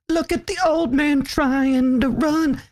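high, slow teasing voice; mocking taunt. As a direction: taunt him like a teenage girl. Mocking teenage girl taunt.

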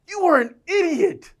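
high, loud, exasperated jab at a friend; hammed-up frustrated yell at a friend in the car; mock angry outburst. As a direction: snap it like a fed-up buddy. Arguing with Abe in the car.

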